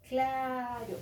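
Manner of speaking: "claro" with a held first vowel; blended sounds, stretched. Shown as a demonstration. Teacher repeats C-L example, drawing out "claro". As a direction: join C and L, then lengthen the "a" in "claro". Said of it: "y juntas".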